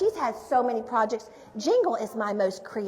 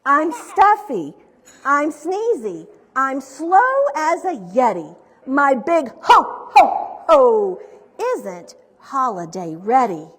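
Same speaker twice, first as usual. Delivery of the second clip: sick Santa's complaint, punched out word by word; 10 dB louder, slower than usual. A narrator's reading. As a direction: voice a poorly Santa with emphatic, swinging pitch.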